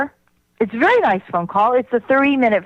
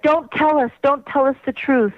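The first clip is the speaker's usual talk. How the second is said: mocking senators; 7 semitones higher than usual. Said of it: "And they're like".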